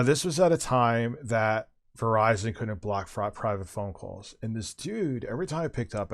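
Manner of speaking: ordinary delivery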